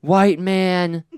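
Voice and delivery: loud, high yell; guy yelling from a car, a loud mock yell. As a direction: shout it from a passing car. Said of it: "this guy drives by and yells".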